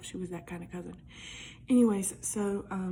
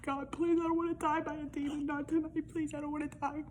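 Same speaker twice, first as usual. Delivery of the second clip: higher, pleading mock-terrified prayer; retold panicked prayer; 6 semitones higher than usual. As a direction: beg God like a scared kid in bed.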